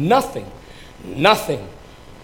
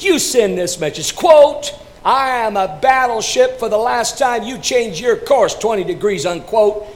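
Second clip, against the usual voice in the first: higher, louder furious order; livid shout; 8 semitones higher, 6 dB louder than usual. Acted. Livid captain shouting orders to the signalman. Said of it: "he shouts to him".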